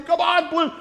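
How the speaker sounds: loud, high cheer for the team. Retold or imitated. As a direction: shout the team on. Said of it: "cheering on our team".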